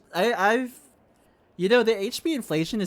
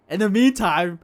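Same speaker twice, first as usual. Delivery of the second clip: normal talking voice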